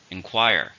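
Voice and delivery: "Inquire" stressed on QUIRE; stress on second syllable. Shown as a demonstration. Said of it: "stress in the second".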